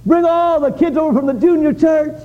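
rising to a joyful shout; excited announcement. Retold or imitated. Pastor retelling his plan to the church, excited shouting. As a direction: pastor proclaiming a glorious march, building to a shout. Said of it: "and so I thought".

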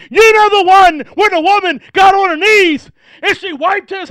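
loud, high, rising preacher's call; full-force preaching. Acted. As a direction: press the eunuch, building to a shout. Preaching as Philip continues.

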